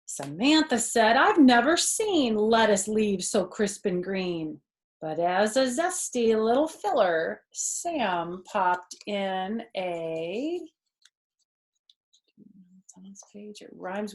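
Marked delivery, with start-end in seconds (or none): 0.1-10.6 put-on lively read-aloud for a child; animated children's story narration; storybook reading. A narrator's reading. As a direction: read it like a bedtime picture book. Reading picture book aloud.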